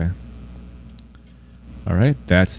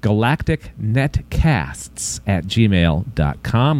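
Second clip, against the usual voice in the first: very slow, lower email read-out; slow repeat; 4 semitones lower, much slower than usual. Shown as a demonstration. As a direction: spell out the address at half speed. Same email repeated much slower.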